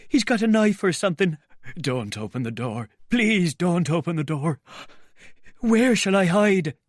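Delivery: high, loud frantic begging; panicked pleading. Acted. Marvel begging the men to keep the door shut. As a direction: plead frantically not to open up.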